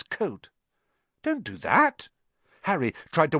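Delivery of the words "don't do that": high-pitched, urgent objection; alarmed protest. A narrator's reading. Harry to an owl attacking the coat, alarmed. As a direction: call out a sharp protest.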